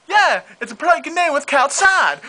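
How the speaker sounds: high squeaky cartoon voices, jumbled syllables; spoonerism skit.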